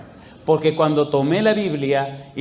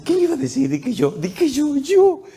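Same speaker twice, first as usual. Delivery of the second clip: high imitated tearful amazement, climbing; acted overwhelmed cry; crying and laughing; 11 semitones higher than usual. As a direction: squeal in disbelief through mock tears. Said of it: "lloraba y se reía al mismo tiempo".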